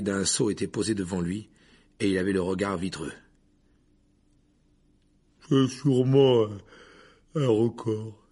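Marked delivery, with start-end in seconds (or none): 5.5-8.2 put-on slow, thick drunken slur; slurred drunk voice. A narrator's reading. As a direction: speak like you've had too much. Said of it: "dit-il d'une voix pâteuse".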